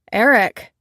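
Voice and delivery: loud, sharp call of the name. Acted. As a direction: cut in hard to stop him. Stella braced, cuts in.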